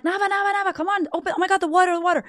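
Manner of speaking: high-pitched panicked mom, words tumbling out; freaking out. Retold or imitated. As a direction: re-enact a frantic reaction to a spill. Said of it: "I'm freaking out".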